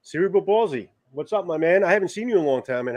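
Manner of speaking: normal talking voice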